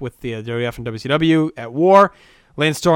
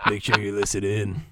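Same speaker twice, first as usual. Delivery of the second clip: put-on old man perv voice; 5 semitones lower, 7 dB quieter than usual. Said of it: "my Scott Old Man Perv voice".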